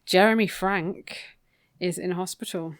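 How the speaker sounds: ordinary delivery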